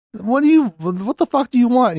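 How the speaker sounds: imitating an impatient challenge; retold blunt demand. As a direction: pitch jumps up into an acted demand. Retelling the villain's blunt demand.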